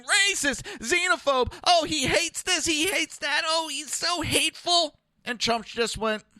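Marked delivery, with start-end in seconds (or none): 0.0-5.0 high-pitched mock outcry of accusers; mock screaming. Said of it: "when they scream".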